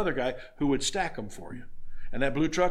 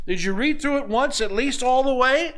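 high, louder pleading question; incredulous questioning; 10 semitones higher, 6 dB louder than usual. Acted. Christ questioning a believer at judgment, incredulous questioning. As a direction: press the question, voice climbing high.

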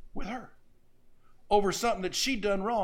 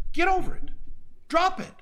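much higher and louder, blunt and insistent; exasperated rebuke; 7 semitones higher, 4 dB louder than usual. Retold or imitated. Pastor rebuking a woman nursing a grudge, loud insistent rebuke. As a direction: hammer it home, spacing the last words.

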